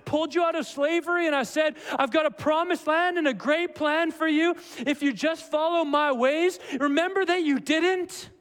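high, forceful, relentless reminding; impassioned messenger's reproach. Acted. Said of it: "This is a messenger coming and saying".

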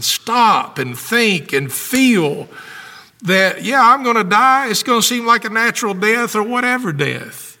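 high, impassioned preacher at full force; fervent preaching. Real, not acted. Pastor urging the congregation to stop and feel, fervent preaching. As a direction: preach it urgently, building in pitch and power.

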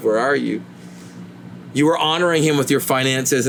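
ordinary talk

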